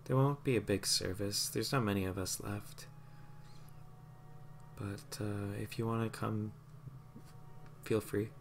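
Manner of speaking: soft, subdued reply; grieving woman. Acted. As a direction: speak gently, weighed down by loss.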